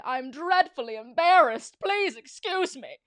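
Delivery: loud flustered villain voice; embarrassed villain. Acted. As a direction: fluster loudly, mortified.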